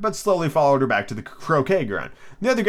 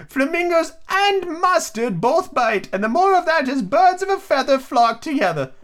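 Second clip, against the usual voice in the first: put-on very high, slow, prim old lady; Duchess voice; slower than usual. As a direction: hold the Duchess's high sing-song. Duchess voiced in read story.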